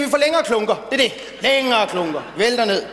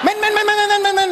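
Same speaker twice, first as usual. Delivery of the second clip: flustered stammer; 7 semitones higher, faster than usual. Acted.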